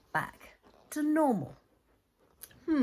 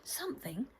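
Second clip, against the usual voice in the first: hushed, breathy build-up; suspense whisper; 8 dB quieter, faster than usual. A narrator's reading. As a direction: whisper it like a secret is coming. Suspense before odd event.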